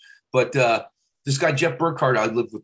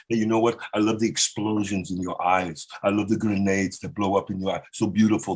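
romantic Schwarzenegger; 5 semitones lower than usual. Retold or imitated. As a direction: croon it like Arnold in love. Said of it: "make 'em, like, sound romantic".

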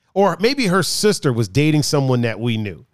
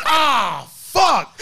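frustrated yell; 7 semitones higher than usual. Retold or imitated. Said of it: "She was like".

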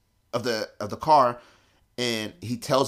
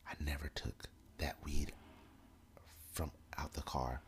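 whispered confession; 9 semitones lower, 18 dB quieter than usual. Retold or imitated. Friend confesses to teller mid-search, hushed whisper. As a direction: confess it under your breath so the cop can't hear.

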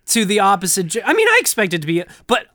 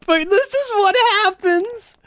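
laugh-crying. Real, not acted. High wail.

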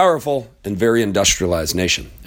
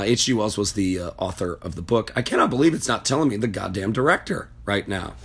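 normal talking voice

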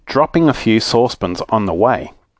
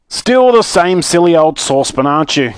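raised, higher, exclaiming; cried out; 6 semitones higher, 3 dB louder than usual. Acted. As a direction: exclaim it at an exasperating old friend. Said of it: "cried Mister What's-his-name".

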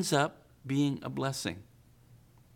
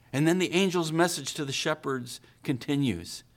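ordinary talk, nothing marked

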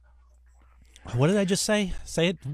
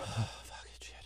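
pained whisper; 4 semitones lower, 16 dB quieter, slower than usual. Real, not acted. Hot sauce pain, whispered curse.

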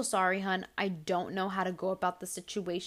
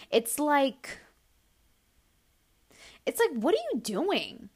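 ordinary talk, nothing marked

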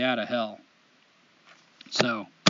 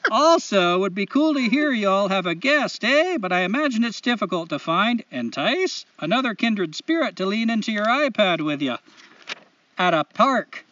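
put-on Canadian accent; 2 semitones higher, 3 dB louder than usual. Host reading a listener's letter aloud, hammed-up Canadian accent. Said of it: "in the best Canadian accent I know".

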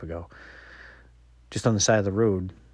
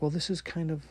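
put-on higher, hesitant teen voice groping for words; stumped daughter; 9 semitones higher than usual. Daughter stumped when dad asks her music genre, higher and hesitant. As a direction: play the daughter searching for a label. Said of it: "she gets stumped".

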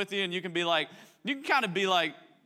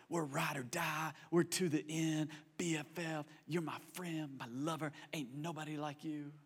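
tender devotion; 8 dB quieter than usual. Retold or imitated. Newlywed whispering devotion to a spouse, tender. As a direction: murmur it to your spouse. Early marriage vows remembered.

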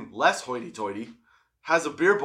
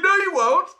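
much higher and louder, scoffing; cocky dismissal; 7 dB louder than usual. Retold or imitated. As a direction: brush off the threat, laughing at it. Retold dismissive retort to challenger.

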